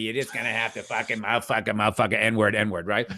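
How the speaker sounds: low, lightly voiced tough guy; lightly voiced brash waiter ranting; impression of Curtis. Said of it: "That's a good impression of him".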